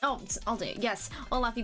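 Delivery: ordinary delivery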